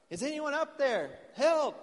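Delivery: high-pitched shout up a cliff; acted cry for rescue, loudest on the last word; yelling for help. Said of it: "He starts yelling".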